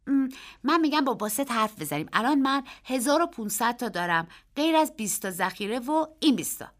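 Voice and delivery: put-on a boy bragging about his winnings; eager boy. A narrator's reading. Narrator voices the boy. (پسربچهٔ مشتاق با صدای زیر و بلند)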